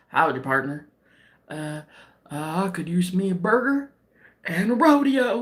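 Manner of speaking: imitating a reluctant Brit doing American cowboy; low, hesitant fake American cowboy accent; mock American accent. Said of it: "Try the American accent".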